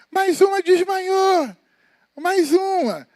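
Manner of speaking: high, loud, drawn-out cry of alarm; shouted alarm. Retold or imitated. Woman in the metro shouting that another passenger fainted, high and loud. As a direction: call it out down the crowded train car. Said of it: "tu escuta o grito".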